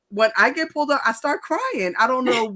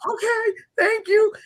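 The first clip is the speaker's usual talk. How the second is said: small high-pitched thank-you; mock tearful thanks; 10 semitones higher than usual. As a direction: imitate a meek, weepy driver let off. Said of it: "I couldn't stop crying".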